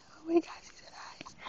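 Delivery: real, excited hushed whisper; awed whisper. Real, not acted. Parent amazed that the baby said eyes, excited whisper.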